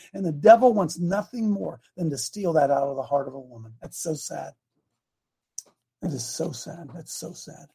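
real sorrow, voice dropping low and very soft; caught up in emotion. Real, not acted. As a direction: fade into a hushed, overcome near-murmur. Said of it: "I got caught up in my own emotion".

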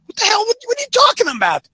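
high-pitched, incredulous rebuke; exasperated outburst. Acted. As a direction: cut in with indignant disbelief. Rebuking the average guy's excuse.

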